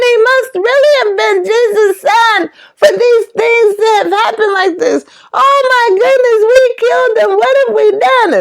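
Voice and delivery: high-pitched acted alarm; panicked horror. Crowd realizes they killed God's son, acted panic. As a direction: wail it like a horror-struck crowd. Horrified crowd in retold play.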